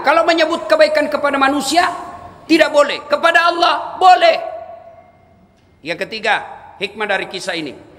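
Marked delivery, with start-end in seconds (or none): 0.0-4.4 higher, loud emphatic preaching; emphatic shout. Real, not acted. Preacher warning the congregation, shouting for emphasis. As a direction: drive the point home forcefully. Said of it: "preacher stresses rule".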